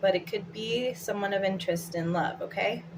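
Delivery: ordinary talk, nothing marked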